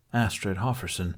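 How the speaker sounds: usual speaking voice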